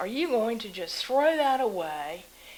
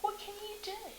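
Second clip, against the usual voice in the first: pleading question; 11 semitones higher, 7 dB quieter than usual. Real, not acted. Rhetorical plea at town meeting.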